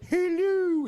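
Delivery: loud, very high falsetto hoot; silly belly voice. Retold or imitated. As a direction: blurt it in the squeaky belly-button voice. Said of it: "and so he was like".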